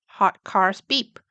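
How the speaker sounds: usual speaking voice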